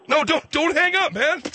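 high-pitched urgent cry to stop him; high, panicky protest; alarmed plea. Pretend. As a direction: plead frantically, voice leaping way up. Caller is about to hang up.

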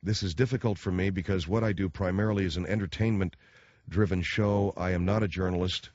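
ordinary talk

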